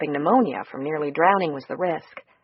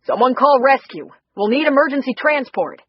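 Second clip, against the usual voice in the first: loud and higher, shouting for rescue; urgent call for help; 6 semitones higher, 7 dB louder than usual. Acted. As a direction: yell across the riverbank for help, urgently. Drowning man, calling for help.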